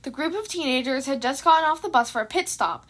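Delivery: ordinary delivery, nothing marked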